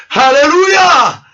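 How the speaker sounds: preacher's jubilant cry; exultant alleluia. Real, not acted. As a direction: stretch the Alléluia high in triumph. After laugh, exultant alleluia.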